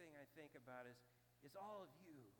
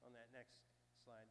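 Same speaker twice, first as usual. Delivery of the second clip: ordinary talk, nothing marked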